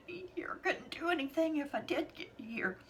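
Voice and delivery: whispered as tears come; barely voiced, holding back sobs; choked whisper. Real, not acted. Breaking whisper.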